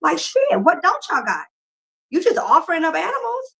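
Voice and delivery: outraged what-the-hell, voice climbing; fed up and incredulous at the owner. Retold or imitated. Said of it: "I'm like".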